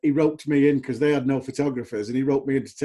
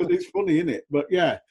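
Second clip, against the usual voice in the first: ordinary talk, nothing marked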